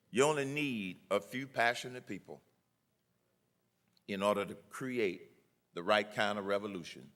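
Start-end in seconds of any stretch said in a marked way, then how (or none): none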